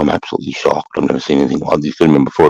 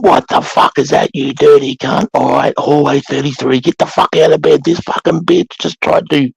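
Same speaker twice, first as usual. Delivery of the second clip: yelling at recruit; 10 semitones higher than usual. Retold or imitated. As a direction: bawl her out like a drill sergeant. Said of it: "And I just went".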